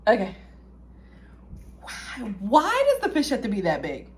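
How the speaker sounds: ordinary talk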